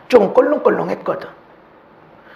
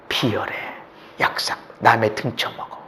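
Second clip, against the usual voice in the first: hushed; 10 semitones lower, 8 dB quieter than usual. Acted. Hushed condemnation.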